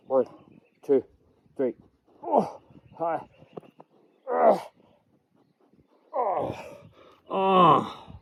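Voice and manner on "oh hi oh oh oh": high, breathy grunts of effort; straining groans. Real, not acted. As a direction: groan as the abs burn. Exertion at end of set.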